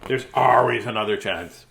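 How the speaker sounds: plain reading voice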